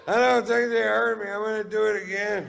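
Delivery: very high, loud holler; high-pitched oblivious shouting; mock drunken yell. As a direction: yell it out so the whole harbor hears. Drunk shouting on, thinking nobody heard.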